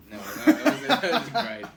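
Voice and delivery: loud, laughing through the words. Real, not acted. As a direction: crack up while correcting. Laughing correction of band name.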